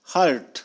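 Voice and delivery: हर्ट with a clear R before the t; American R in हर्ट. Shown as a demonstration. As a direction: sound the R in हर्ट. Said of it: "American करते हैं".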